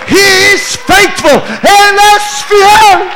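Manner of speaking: shouted high, slow preaching climax; full-force preaching. Real, not acted. Preacher to congregation after the check arrived, shouted praise. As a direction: proclaim God's faithfulness at the top of the voice.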